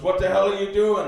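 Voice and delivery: high, loud mock outrage; angry grandpa. Grandpa finds grandson scrubbing with sandpaper, angry shout.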